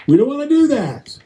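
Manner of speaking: high, fast, whiny backing-down voice; a man caving in to tears, in a high mock plea; mock pleading man. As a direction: squeak out a flustered apology. Mocking men avoiding tears.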